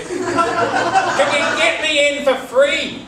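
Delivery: high, loud, drawn-out cheeky ask. Acted. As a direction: stretch the cheeky question out slowly. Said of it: "said Andy".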